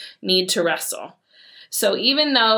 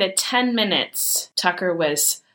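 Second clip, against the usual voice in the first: ordinary talk, nothing marked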